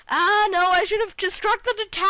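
high-pitched cry of self-reproach; dismayed outburst. Real, not acted. Gamer kicking himself over a bad shot, dismayed cry. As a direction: wail it in frustration at your own blunder.

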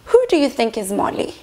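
ordinary delivery

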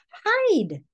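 high, urgent warning; urgent mother. Acted. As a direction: hurry him away in fear. Mother urges son to hide.

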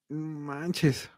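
very soft, under the breath; retold stunned mutter to oneself; dismayed aside. Stunned aside at nurse's question. (susurro de incredulidad)

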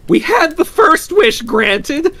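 very high, loud, drawn-out insistence; feverish. A narrator's reading. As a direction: argue as if in a fever. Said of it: "said the old woman feverishly".

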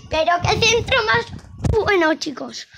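high, loud commentator rave; excited commentary. Acted. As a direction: rave about the cross like a commentator. Praising a cross, then repeating.

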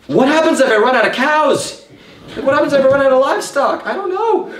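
high, laughing mock worry about running out of cows; half-laughing panic. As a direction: giggle through the fake alarm. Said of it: "I'm sitting there going".

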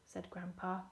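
ordinary delivery, nothing marked

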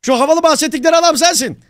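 high, eager in-character exclamation; excited accosting. Acted. Wannabe troll hunter to his supposed hero, excited accosting.

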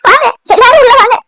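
high, loud calling out; a grandmother loudly calls the family inside; loud welcome call. Acted.

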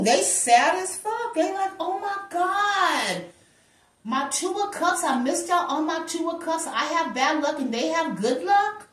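hammed-up self-pity; mock sad lament. The ex moaning about missed love, in mock sadness.

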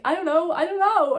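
high, loud re-enacted panic; overwhelmed, voice jumping up; flustered outburst. Patient drilled with questions, acted frantic helplessness. Flustered answer under interrogation.

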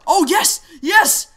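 very high, very loud cheer; explosive high victory yell; triumphant scream. Real, not acted. Celebrates a kill in the game.

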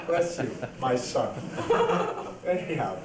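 usual speaking voice

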